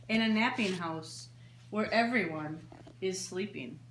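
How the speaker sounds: lilting singsong storybook read; reading to children. A narrator's reading. Librarian reading a bedtime book to children, gently lilting. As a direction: read it like story time for little ones. Reads picture book aloud.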